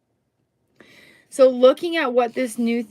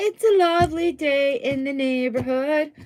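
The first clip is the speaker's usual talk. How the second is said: a touch of Mister Rogers cheer; sing-song greeting; 4 semitones higher than usual. Pretend. Reader greets viewers in a sing-song way. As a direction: lilt it like a kids' show host.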